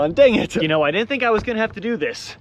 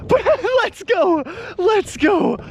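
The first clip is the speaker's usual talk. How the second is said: excited; 12 semitones higher, slower than usual. Real, not acted. Celebrating the catch.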